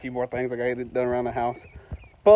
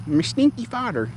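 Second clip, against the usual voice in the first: high teasing singsong; playful high childish teasing; mock taunt; 8 semitones higher, 5 dB quieter than usual. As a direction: tease like a child.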